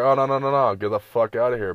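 loud, rapid-fire refusal; guards turning people away. Acted. Guards spotting a few early campers, loud dismissal. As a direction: shoo them off loudly.

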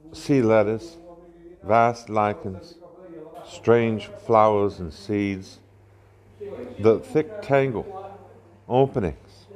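put-on measured poem recital in a Southern accent. Poet reads Whitman aloud in a public cafe, Southern accent. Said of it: "I'm going to read it with a Southern accent".

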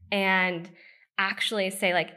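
ordinary delivery

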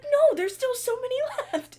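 high, loud, protesting. Retold or imitated. Daughter retelling her protest to mom, high and loud. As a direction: squeal that there's plenty left. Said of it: "I was like".

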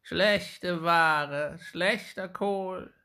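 put-on high, slow, creaking old hag; creaky witch voice. A narrator's reading. Wicked old woman taunting little Jakob, high creaky and slow. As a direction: taunt the boy sweetly like a wicked crone. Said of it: "sprach auch hier".

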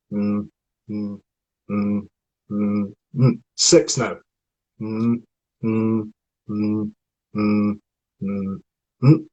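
humming with the tongue circling the gums; tongue workout counting. Shown as a demonstration. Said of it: "here we go".

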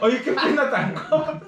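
high, slow teasing through laughter; laughing mock remark. Mocking the girl's leg among friends, slow laughing tease. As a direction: drag out the joke while laughing.

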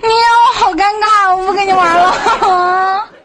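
loud, whiny fake sob; mock crying. Real, not acted. Caller fake-cries in embarrassment.

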